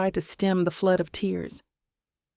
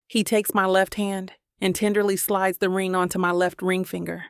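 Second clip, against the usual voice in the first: usual speaking voice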